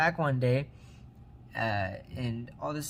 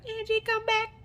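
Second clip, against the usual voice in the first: high falsetto call after someone leaving; hammed-up plea in a squeaky, very high voice; mock pleading. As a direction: wail it comically high, imitating a dumped boyfriend. Voices Oscar calling after Angie.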